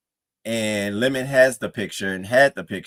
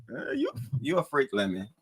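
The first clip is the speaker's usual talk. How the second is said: ordinary talk, nothing marked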